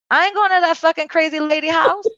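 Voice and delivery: high-pitched, scornful refusal; mock the kooky aunt, voice pitched high; sassy mockery. Mimics old self mocking the aunt.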